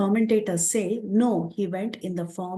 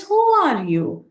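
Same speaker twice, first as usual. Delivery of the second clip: higher, louder storyteller's challenge; insistent questioning; 7 semitones higher, 6 dB louder than usual. Acted. Hanuman questions two puzzling strangers, insistently. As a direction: press the question on WHO, high then falling.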